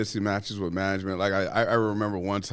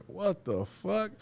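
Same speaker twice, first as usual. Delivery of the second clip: stunned disbelief; 11 semitones higher, 4 dB quieter, much slower than usual. Retold or imitated. Incredulous reaction in retold story.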